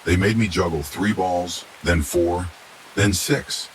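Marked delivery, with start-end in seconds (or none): none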